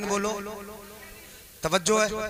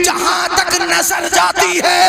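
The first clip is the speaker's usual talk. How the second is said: full-force preaching; 4 semitones higher, 7 dB louder than usual. Acted. Preacher exhorting the congregation, full-force sermon chant. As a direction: preach it with mounting fervour.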